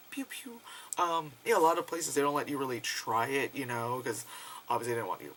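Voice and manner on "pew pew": high, soft "pew pew"; playful gun noises. Pretend. Vlogger showing off an airbrush gun, playful pew-pew noises. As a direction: imitate a tiny toy ray gun.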